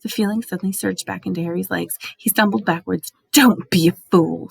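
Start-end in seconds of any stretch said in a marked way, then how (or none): 3.3-4.5 snarled. A narrator's reading. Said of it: "snarled the voice".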